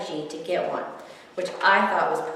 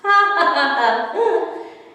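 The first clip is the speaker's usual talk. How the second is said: loud high open laugh; boy laughing; 9 semitones higher, 8 dB louder than usual. A narrator's reading. Boy laughs while Theodore is about to eat him, loud laugh. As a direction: laugh out loud like a delighted kid. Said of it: "The boy laughed".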